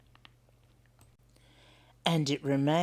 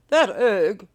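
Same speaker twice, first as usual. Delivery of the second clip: louder, worried emu; a fretting bird neighbour; 3 semitones higher, 8 dB louder than usual. Acted.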